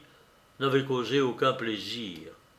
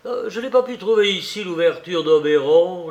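lightly voiced chatty suitor, higher and louder; lekko odegrany Panchine; 4 semitones higher, 6 dB louder than usual. A narrator's reading. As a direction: speak up as a sociable character.

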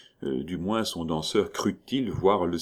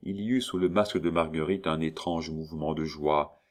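ordinary delivery, nothing marked

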